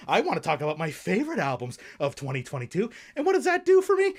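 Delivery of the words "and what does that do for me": high, louder indignant question; angry outburst. Acted. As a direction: snap at the viewers. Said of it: "Sorry for that rant earlier".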